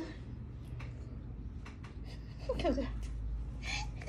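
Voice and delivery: long airy soft laugh; breathless laugh. Real, not acted. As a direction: laugh almost silently, breath only. Laughs at the pet. (cười hơi không thành tiếng)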